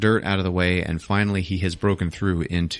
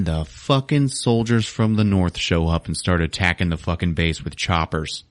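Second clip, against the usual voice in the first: ordinary delivery, nothing marked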